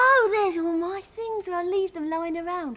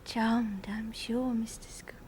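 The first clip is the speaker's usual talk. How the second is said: subdued reply; 7 semitones lower, 10 dB quieter than usual. Acted. Subdued acceptance after the quarrel.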